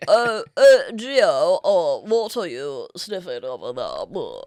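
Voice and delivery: garbled mock British accent, starting loud; hammed-up British babble. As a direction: ham up a mushy British accent. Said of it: "you know, British accent".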